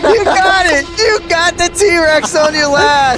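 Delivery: high, thrilled play-by-play call; excited shout. Real, not acted. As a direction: celebrate the winning goal. Caster celebrates game-winning goal.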